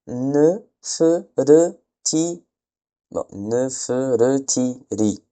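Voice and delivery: "ne, fe, re, ti" spelled out slowly, one sound at a time; sounding out syllables. Shown as a demonstration. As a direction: read the signs aloud like a teacher building a word. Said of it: "Donc, on a ne". (syllabes « ne, fe, re, ti » détachées lentement)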